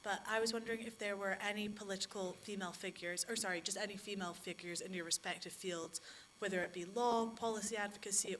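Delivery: ordinary talk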